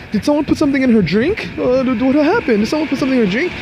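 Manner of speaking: high, loud panicked questions; imitating the boyfriend's loud high-pitched alarm; frantic questioning. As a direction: demand answers in a panic.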